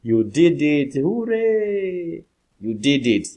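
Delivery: high, loud hooray; celebrating someone's success; joyful cheer. Shown as a demonstration. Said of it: "at the end".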